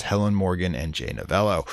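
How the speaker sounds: usual speaking voice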